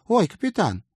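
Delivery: high startled squeak; squeaky voice. A narrator's reading. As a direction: squeak it out in surprise. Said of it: "пискнула мисс Отвёртка".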